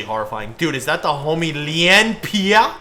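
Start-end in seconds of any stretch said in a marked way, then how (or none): 1.5-2.7 hammed-up French accent, loud and drawn out; bad mock French, raised in pitch; horrible French accent. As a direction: put on a terrible French accent. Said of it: "my horrible French accent".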